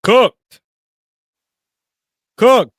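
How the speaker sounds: high, loud single-word yell; shouting cooked at the screen; shouted verdict. Real, not acted. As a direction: bellow it like a final whistle. Reacting to the host's blunder.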